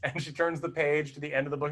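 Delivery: normal talking voice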